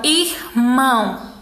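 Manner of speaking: IRMÃO as ir, pause, mão; split into syllables. Shown as a demonstration. Teacher summing up syllables, irmão split in two. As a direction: say irmão in two separate beats. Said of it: "duas sílabas".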